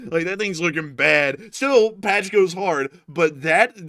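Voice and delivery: ordinary talk, nothing marked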